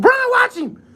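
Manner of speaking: re-enacted high, loud scream at the player; screamed warning. Fan screaming at LeBron about a sneak steal, high yell. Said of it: "I was screaming".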